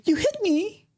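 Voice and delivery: very high, whining complaint; whiny cry. Retold or imitated. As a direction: mimic a hurt crybaby. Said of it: "is a cry".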